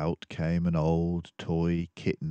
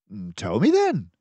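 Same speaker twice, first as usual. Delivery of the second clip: mildly annoyed Dawson; 4 semitones higher, 8 dB louder than usual. Acted. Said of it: "somewhat annoyed at his tone".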